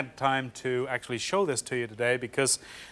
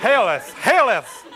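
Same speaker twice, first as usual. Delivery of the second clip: high, loud hammed-up "Haileth, haileth"; loud and high, laughing; mock hailing cry; 7 semitones higher, 6 dB louder, slower than usual. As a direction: call out like a pompous herald. Comic march to kitchen.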